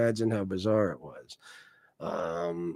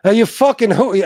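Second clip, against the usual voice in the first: imitating Nick; 8 semitones higher, 10 dB louder than usual. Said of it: "I can't do his voice right now".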